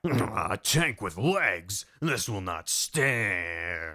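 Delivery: very slow, low mock-solemn vow; hammed-up gritty resolve; grave hero voice. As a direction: drag it out low like an action hero. Snake's grave vow, acted.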